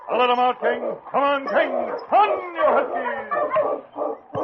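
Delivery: high, loud, drawn-out cry to the huskies; straining musher's cry; shouting. Acted. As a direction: give the team everything in the final dash. Final sprint, driver urges team.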